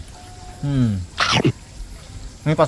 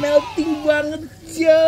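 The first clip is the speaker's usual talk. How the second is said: excited; 11 semitones higher, much slower than usual. Real, not acted. Showing the melting cheese.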